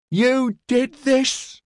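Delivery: high, slow, desperate scream. A narrator's reading. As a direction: scream it in despair. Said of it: "a woman screamed at him".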